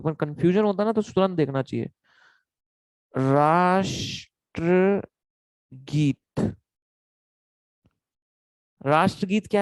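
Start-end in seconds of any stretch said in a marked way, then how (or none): 3.2-6.5 very slow, stretched syllable by syllable; drawn-out word. Real, not acted. Teacher looking up a term mid-class, drawn out slowly. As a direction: draw each syllable out with gaps between.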